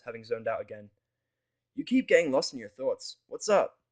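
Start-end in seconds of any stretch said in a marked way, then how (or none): 1.7-3.8 a touch higher, louder prodding mare; Vinyl's voice. Acted. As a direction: nudge him to talk. Vinyl asks again.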